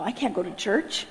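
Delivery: low, soft muttered protest; hushed refusal. Retold or imitated. Balking at God's idea of church, muttered low. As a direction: mutter it under your breath, low.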